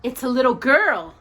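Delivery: loud witch declaration; declared. A narrator's reading. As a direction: announce it as a witch. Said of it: "she declared".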